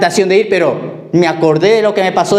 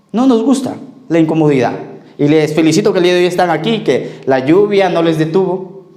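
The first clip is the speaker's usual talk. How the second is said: higher, fervent sermon voice; preaching; 4 semitones higher than usual. Acted. Pastor telling the congregation about the eagle, fervent preaching. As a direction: press the point home to the flock.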